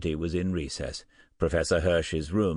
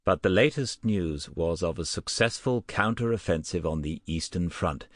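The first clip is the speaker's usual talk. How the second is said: usual speaking voice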